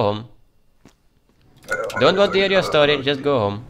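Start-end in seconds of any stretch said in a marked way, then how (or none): 2.0-3.6 loud dismissal. Acted. Dismissing entrant.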